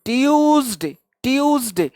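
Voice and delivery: "ट्यूसडे" drawn out on the "ट्यू" glide; pronunciation demo. Shown as a demonstration. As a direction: model "ट्यूसडे" as tyooz-day for pupils. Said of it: "ऐसे होता है, देखो". ("ट्यूसडे" का "ट्यू" खींचकर सिखाते हुए)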